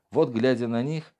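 plain reading voice

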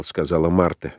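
plain reading voice

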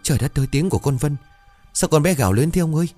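high, fast, alarmed voice; panicked mother. A narrator's reading. Mother wakes to her daughter's scream, panicked. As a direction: blurt it out in panic, high and quick.

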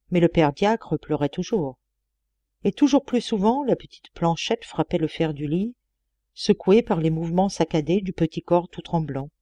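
ordinary delivery, nothing marked

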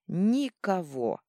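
slow, loud bark; barking shout. A narrator's reading. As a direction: bark the word out. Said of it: "снова рявкнул Аркей".

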